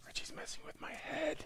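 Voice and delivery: high airy whisper. Pretend. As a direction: whisper it like a secret. Whispered aside.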